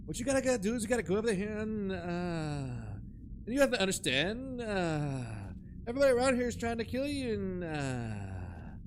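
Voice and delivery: lightly voiced guy trailing off with uh; imitating a rambling YouTuber. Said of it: "it's called lispy".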